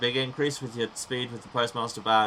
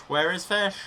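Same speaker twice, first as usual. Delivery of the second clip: high loud call for fish; 9 semitones higher, 7 dB louder than usual. Pretend. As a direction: call out for the fish, pitched up and louder. Repeated calls, high loud take.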